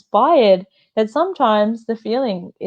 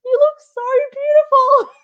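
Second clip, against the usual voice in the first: very high, mock blubbering wail; imitated crying. Daughter reacting to mum's outfit photo, exaggerated tearful wail. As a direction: wail it as if overcome with happy tears. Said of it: "I would just cried".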